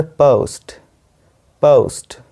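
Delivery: पोस्ट with a gliding "oh"; correct pronunciation. Shown as a demonstration. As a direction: say पोस्ट as British "pəust". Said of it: "इसका जो सही उच्चारण है".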